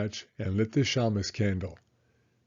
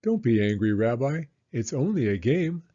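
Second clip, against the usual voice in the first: Baba Brena; 4 dB louder than usual. Acted. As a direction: play the kindly old hostess, pitched up. Baba Brena soothing the bear.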